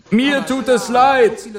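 calling back. A narrator's reading. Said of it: "rief der Doktor ihr ebenso laut zurück".

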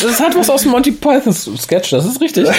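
laughing. Real, not acted. Laughing about Monty Python.

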